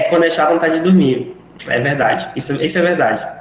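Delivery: usual speaking voice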